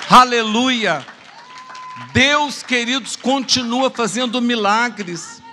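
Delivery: loud, high hallelujah proclamation; exultant preaching. Real, not acted. Preacher praising God before the congregation after a miracle story, exultant. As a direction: proclaim it, stretching the final words.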